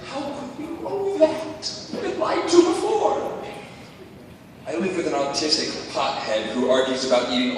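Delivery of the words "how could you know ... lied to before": high, slow doubting voice; a high, slow mocking skeptic; skeptical audience voice. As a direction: squeak out the doubt of a wary crowd. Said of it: "you may be asking".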